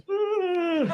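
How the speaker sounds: high drawn-out mock yawn "Mm"; loud yawn groan. The guy behind yawning out loud for hours, a high drawn yawn. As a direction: moan a long high yawn. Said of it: "he's like".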